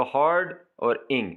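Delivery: "हॉर्ड" और "इंग" as two separate beats; syllable split. Shown as a demonstration. Teacher showing learners two syllables, split apart. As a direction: pause between "हॉर्ड" and "इंग". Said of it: "syllables को separate कर रहा है".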